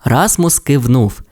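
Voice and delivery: ordinary delivery, nothing marked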